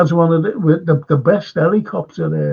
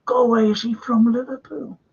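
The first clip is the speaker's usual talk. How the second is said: mock disbelief; 6 semitones higher, 3 dB quieter than usual. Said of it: "That you go".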